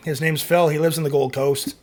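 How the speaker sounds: normal talking voice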